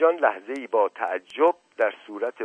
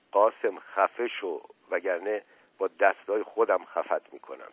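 ordinary delivery, nothing marked

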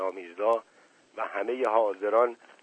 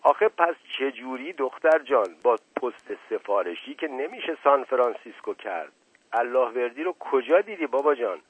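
usual speaking voice

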